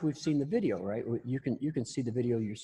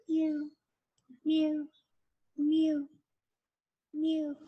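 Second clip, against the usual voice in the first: high little meows; kitten meow; much slower than usual. Retold or imitated. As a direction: imitate a harmless kitten. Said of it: "except say".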